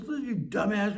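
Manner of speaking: high-pitched mock telling-off; imitating an exasperated sponsor; acted scolding. Sponsor scolds him in acted quarrel.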